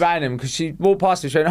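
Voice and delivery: usual speaking voice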